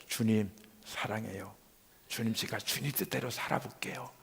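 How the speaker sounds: hushed promise; soft devoted prayer. Retold or imitated. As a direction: pray it gently, almost privately. Retold prayer of love and surrender.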